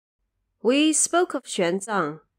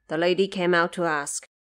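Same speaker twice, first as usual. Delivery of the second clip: ordinary talk, nothing marked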